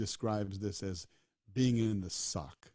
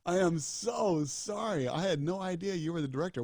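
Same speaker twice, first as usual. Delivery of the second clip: flustered apology; 8 semitones higher, 4 dB louder than usual. Retold or imitated. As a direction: gush out the apology, caught off guard. Apologizing to the real director.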